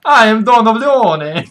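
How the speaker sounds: loud, high mock-Italian don; Italian accent. Owl announcing itself as a don, hammed-up Italian accent.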